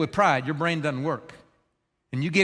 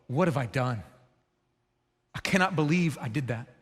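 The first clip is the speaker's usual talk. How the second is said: low and soft, stunned at yourself; quiet horrified regret; dismayed self-realization; 6 semitones lower, 5 dB quieter than usual. Acted. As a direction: murmur it as if the damage just sank in.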